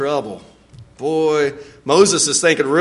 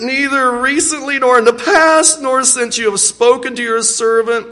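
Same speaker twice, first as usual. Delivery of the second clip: high, loud pleading that fades toward the end; 7 semitones higher, 3 dB louder than usual. Acted. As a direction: beg the Lord with everything. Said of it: "I can hear the pleading in his voice".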